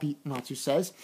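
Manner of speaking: normal talking voice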